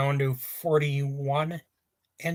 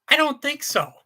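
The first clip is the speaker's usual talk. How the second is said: emphatic denial; 10 semitones higher, 4 dB louder than usual. Real, not acted. Rejecting the claim nascar is dying.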